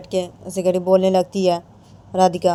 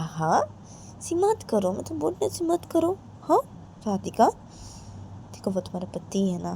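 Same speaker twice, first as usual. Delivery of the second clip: a hint of a gentle mother, quieter; lightly voiced mother, softer; soft persuading mother; 2 semitones higher, 8 dB quieter than usual. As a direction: coax softly like a patient mum.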